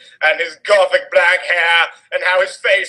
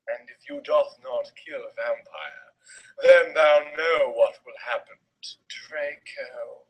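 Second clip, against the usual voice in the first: villain threat; 3 semitones lower, 6 dB quieter than usual. Acted. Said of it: "he shouted".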